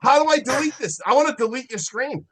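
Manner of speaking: loud, high mock outburst; mock shout. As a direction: yell it like you mean to cut him off. Mock rage, deleting co-host.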